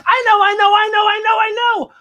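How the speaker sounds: put-on high, loud eager kid; bursting to answer; eager girl. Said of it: "Lucy waves her arms".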